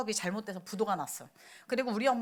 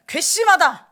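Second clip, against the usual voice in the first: higher, much louder enraged yell; furious outburst; 4 semitones higher, 11 dB louder, slower than usual. Retold or imitated. Immature leader raging at betrayal, furious yell. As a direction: shake with rage at the betrayal. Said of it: "부들부들 떨고 아주 난리가".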